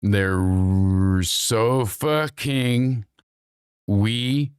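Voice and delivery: "so fucking we Todd did" in separate, stretched pieces; sounded out slowly. Shown as a demonstration. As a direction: draw each word out slowly with gaps between. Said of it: "Say that one more time".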